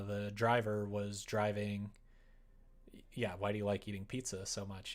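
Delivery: ordinary talk, nothing marked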